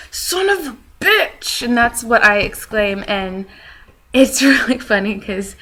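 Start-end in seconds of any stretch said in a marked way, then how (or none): 0.1-1.6 high, drawn-out cursing yell; exasperated exclamation. Retold or imitated. Vlogger showing her own exclamation, a high slow yell. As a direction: let the curse burst out slowly. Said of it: "my exclamation is".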